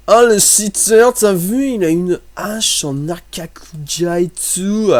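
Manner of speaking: loud, high, drawn-out protest; mock outrage. Player calling out a cheater over his axe, in loud mock outrage. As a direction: cry foul loudly, stretching the words.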